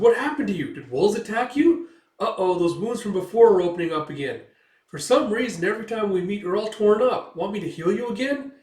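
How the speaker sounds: high, loud voice full of concern; chattering little sidekick. Retold or imitated. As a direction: fuss over him in a perky little voice.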